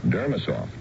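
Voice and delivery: ordinary talk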